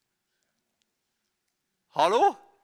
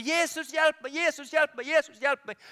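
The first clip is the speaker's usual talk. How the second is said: higher, urgent repeated plea; calling out; 5 semitones higher than usual. Pretend. Believers crying out to Jesus, higher and urgent. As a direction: cry out for help three times. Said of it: "vi roper".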